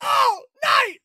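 high party shout; rally the crowd; excited shout. Acted. Hannigan hypes the crowd.

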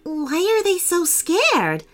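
put-on high-pitched girl asking, baffled; puzzled girl. A narrator's reading. Hallie watches robots flee, puzzled high question. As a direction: voice a young girl's wondering question.

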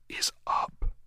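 breathy ghostly whisper of doom. Acted. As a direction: breathe it as a threat. Ghost's final threat.

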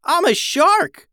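high, loud, drawn-out exclamation; elated shout, pitch leaping way up; excited yell. Acted. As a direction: yell it, thrilled at what you've become. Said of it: "Harvey yelled, pretty excited".

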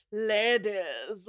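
annoying drawn-out greeting; Robert. Retold or imitated. Robert greeting the ladies, annoyingly affected. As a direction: put on an affected, smug drawl. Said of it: "Robert is so annoying".

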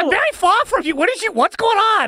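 fast, very high-pitched holler; exasperated possessed hunter. Acted. Distant possessed witch hunter hollers at Cordelia, exasperated. Possessor calls from far away.

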